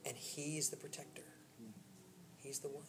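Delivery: soft, low, with long pauses; tender reassurance. Real, not acted. Preacher assuring congregation God never left, hushed tender reassurance. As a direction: let each assurance settle quietly.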